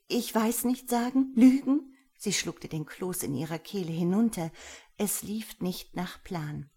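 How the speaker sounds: usual speaking voice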